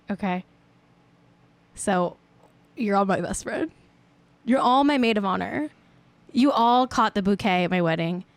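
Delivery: ordinary talk, nothing marked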